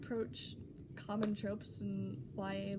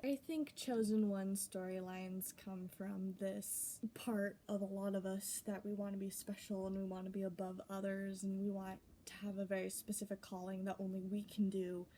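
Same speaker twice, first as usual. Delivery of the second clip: ordinary delivery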